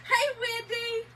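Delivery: high, slow hello through laughter; laughing hello; laughing greeting. Real, not acted. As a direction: still giggling while greeting. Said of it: "greeting while laughing".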